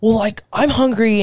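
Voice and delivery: hammed-up sassy complaint; sassy grouch. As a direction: imitate a hungry partner with attitude. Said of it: "hella sass and attitude".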